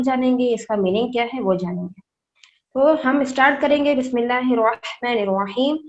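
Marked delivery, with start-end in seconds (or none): 4.0-5.8 measured Quranic recitation. Shown as a demonstration. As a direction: intone it as in tilawat. Teacher begins Quran recitation.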